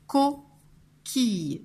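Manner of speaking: "coquille" slowly, split co, quille; syllables separated. Shown as a demonstration. Teacher introducing the word to spell, "coquille" split in syllables. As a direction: pause between the two syllables of "coquille". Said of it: "Première syllabe".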